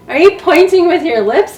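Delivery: high, loud astonished asking; amazed question. Retold or imitated. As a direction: ask in disbelief. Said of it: "And I'm like".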